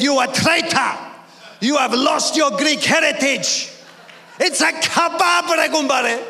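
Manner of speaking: mock-scandalised protest; mock outrage. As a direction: accuse him like a betrayed countryman, playfully. Acted outrage at kebab shop owner.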